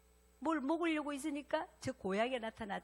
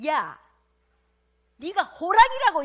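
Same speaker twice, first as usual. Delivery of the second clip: much louder and higher exploding shout; hammed-up mother losing it, yelling; furious mother yell; 8 semitones higher, 15 dB louder, slower than usual. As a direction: blow up at the whining cub. Fed-up mother yells at cub.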